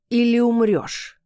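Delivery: cat snaps an irritated threat, slow and loud. A narrator's reading. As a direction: bark the ultimatum at her. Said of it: "бросил кот раздражённо".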